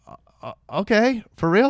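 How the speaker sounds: high, loud, stunned "for real?"; can't believe what he's hearing; surprised disbelief. Retold or imitated. Young actor stunned by the director's suggestion, high disbelief.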